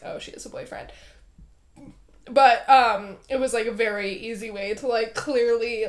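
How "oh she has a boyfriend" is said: unvoiced, hushed aside; whispered. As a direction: whisper it as their realization. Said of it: "they immediately are like".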